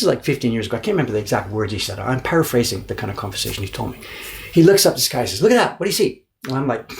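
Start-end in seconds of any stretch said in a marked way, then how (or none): 5.4-6.3 high pointed question; challenging. Retold or imitated. Papermaker challenging his guest, pitched high. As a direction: make him look up and see.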